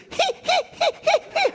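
hyena laugh. Retold or imitated. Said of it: "hyena laugh".

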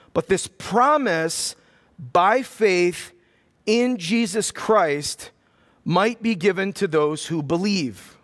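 slow, deliberate reading with long pauses; read slowly. Shown as a demonstration. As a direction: lay each phrase down slowly, one at a time. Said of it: "We're gonna read it slow".

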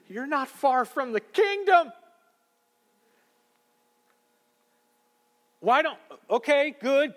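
high, loud eager pleading; eager plea. Retold or imitated. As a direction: press him eagerly toward faith. Retelling excited talk with dad.

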